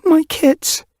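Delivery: high, loud frightened cry; panicked cry. Acted. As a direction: cry out in fear for them. Mother panics about her kits.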